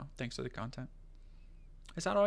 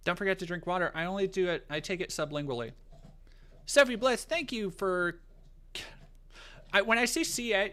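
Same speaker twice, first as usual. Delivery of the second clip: normal talking voice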